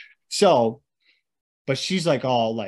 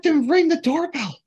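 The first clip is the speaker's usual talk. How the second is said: mock mom; 12 semitones higher, 4 dB louder than usual. Voicing mom.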